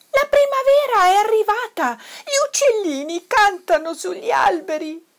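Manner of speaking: high, loud cub's announcement; excited squealing. A narrator's reading. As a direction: burst out with the news in a high child's voice. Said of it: "sguittì Toddy".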